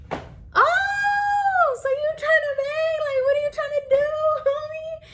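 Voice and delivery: very high-pitched, hammed-up challenge; mock confrontation. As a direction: squeal it like you're squaring up for a fight. Acts out squaring up to fight.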